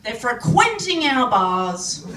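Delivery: loud, hammed-up indignant rant; hillbilly rant. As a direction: rail at the foreigners in mock outrage. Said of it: "I'm showing my hillbilly roots".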